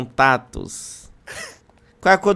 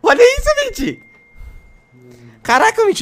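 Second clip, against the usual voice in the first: high, loud excited yell; excited shout; 5 dB louder than usual. Real, not acted. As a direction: call out the crew in disbelief. Shocked at a message.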